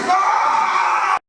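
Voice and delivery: high loud frustrated yell; angry shout. Acted. Angry outburst.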